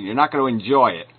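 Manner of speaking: usual speaking voice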